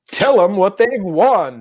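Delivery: high, slow game-show cue; game-show host. Pretend. Host cues co-host to reveal prize, game-show style. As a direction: toss it over like a show host.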